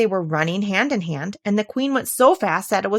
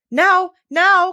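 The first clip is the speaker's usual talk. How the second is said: high, louder shout of hurry; urgent cry; 11 semitones higher, 8 dB louder than usual. Acted. The Queen spurs Alice on, crying out. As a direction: call it out to spur her. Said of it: "cried the queen".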